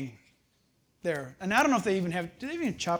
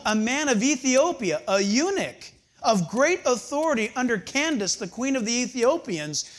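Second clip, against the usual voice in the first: scripture reading; 3 semitones higher, 6 dB louder than usual. Acted. Reading Acts aloud.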